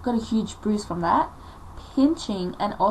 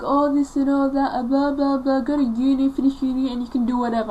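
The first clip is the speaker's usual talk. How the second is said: high, loud singsong imitation of mother; mock nagging mother; 5 semitones higher, 5 dB louder than usual. Mother puts off daughter until uni ends, mock nagging. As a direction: reel off a mother's stock lecture, pitched up. Retells mother dismissing her demands.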